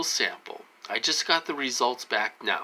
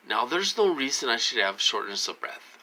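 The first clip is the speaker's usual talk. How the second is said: ordinary talk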